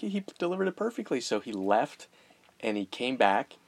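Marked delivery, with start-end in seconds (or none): 0.0-1.2 mock proud. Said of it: "I'm like".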